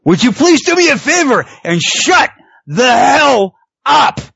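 high-pitched theatrical wrestling promo yell; Jericho. Retold or imitated. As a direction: imitate a heckling wrestler, punching out the last words. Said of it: "would be his W W F debut".

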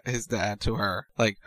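ordinary delivery

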